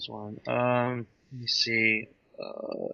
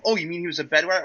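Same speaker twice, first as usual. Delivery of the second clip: higher, louder robot-puppet quip; Crow quote; 6 semitones higher, 6 dB louder, faster than usual. Retold or imitated. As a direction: toss off the jab brightly. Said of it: "as Crow goes".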